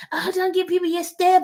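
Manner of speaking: high, mocking voice of naysayers; mock critics. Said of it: "I know if people are like".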